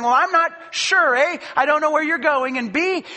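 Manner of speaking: a worried follower protesting; Thomas, puzzled. Acted. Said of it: "Thomas is saying".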